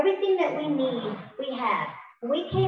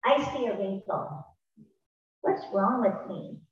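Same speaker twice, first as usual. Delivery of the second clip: mock self-criticism; 4 semitones lower, 4 dB quieter than usual. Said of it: "Miguel was talking about".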